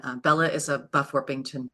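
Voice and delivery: normal talking voice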